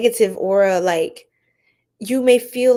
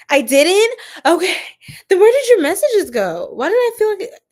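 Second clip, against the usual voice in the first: higher and louder, rising questions; surprised disbelief; 9 semitones higher, 7 dB louder than usual. Real, not acted. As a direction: react in honest surprise. Viewer says she wasn't blocked.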